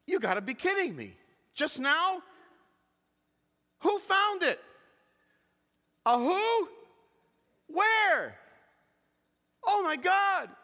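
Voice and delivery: high, incredulous phone reactions; imitated disbelief, pitch swooping up; acted astonishment. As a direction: play the stunned hairstylist taking the call. Acts hairstylist hearing phone was found.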